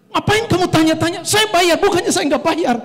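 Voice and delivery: loud, high-pitched retort, re-enacted; angry outburst. Husband re-enacts snapping at taxi driver, angry and loud. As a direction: act out a flare-up of temper. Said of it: "langsung naik emosi saya".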